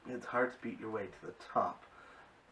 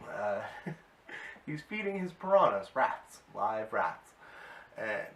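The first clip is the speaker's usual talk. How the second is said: normal talking voice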